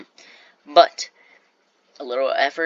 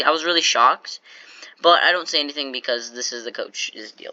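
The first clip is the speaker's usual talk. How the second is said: ordinary talk, nothing marked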